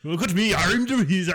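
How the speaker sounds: high, loud mock pirate accent; cartoon pirate accent. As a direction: play a wizard showing off in pirate accent. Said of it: "cartoon pirates".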